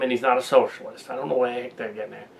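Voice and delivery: ordinary talk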